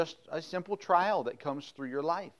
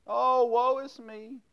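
much higher, slow, hammed-up wail of Oh, woe is me; dramatic woe is me; 10 semitones higher, slower than usual. Shown as a demonstration. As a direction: play the whiny child for laughs.

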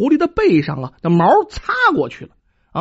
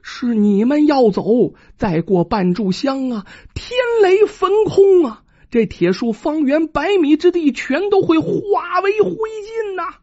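mysterious fox voice; 7 semitones higher than usual. Acted. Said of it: "一个声音在他耳边响起".

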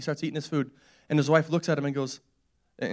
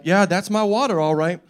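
loud, higher, comic confirmation; mock certainty; 4 semitones higher, 8 dB louder than usual. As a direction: declare it proudly, breaking into a laugh. Joking confirmation after smelling water.

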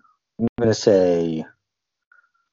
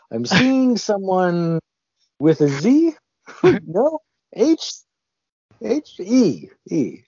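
very high, drawn-out psychic voice; hammed-up medium sensing letters, much higher and louder; fortune teller; 13 semitones higher, 3 dB louder, slower than usual. Guesser posing as a psychic picking random letters, fortune-teller impression. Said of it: "I'm doing my best Teller impression".

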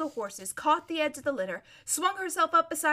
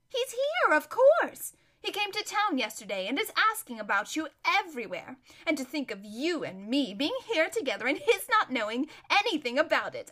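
high, gleeful gossip, giggly at the end; amused, swooping chatter; giddy society lady; 4 semitones higher, 4 dB louder than usual. Acted. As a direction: spill the news with delight.

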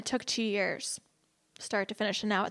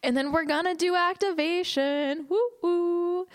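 higher-pitched announcement ending in woos; playful hype; 7 semitones higher, 3 dB louder, slower than usual. Pretend. Teacher announces activation to the class, playfully hyped. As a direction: hype it up, then cheer high.

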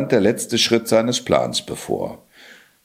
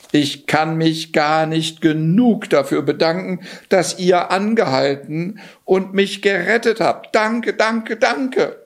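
put-on high, eager boyish voice, climbing on each danke; a shivering boy gushing thanks, going higher; grateful young Johann; 10 semitones higher than usual. Johann thanking the king for rescuing him, high and eager.

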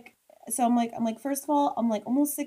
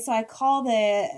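normal talking voice

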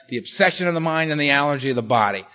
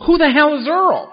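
mock puzzled crowd; 11 semitones higher, 6 dB louder than usual.

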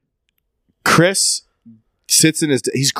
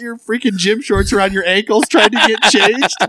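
laughing; 11 semitones higher than usual. Real, not acted. Laughing through hardcore title story.

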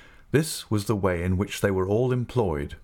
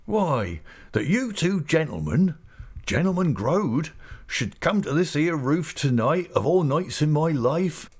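higher-pitched rustic character voice, delighted; Mister Peggotty, greatly animated; 7 semitones higher than usual. A narrator's reading. Overjoyed host welcoming two gentlemen, animated and high. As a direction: marvel aloud in country dialect. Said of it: "with extraordinary animation and pleasure".

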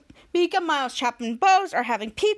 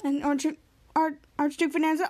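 ordinary talk

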